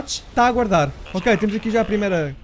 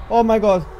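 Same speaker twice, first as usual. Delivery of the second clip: shocked relief; 3 semitones higher, 7 dB louder, slower than usual. Real, not acted. Shock after narrowly grabbing the item.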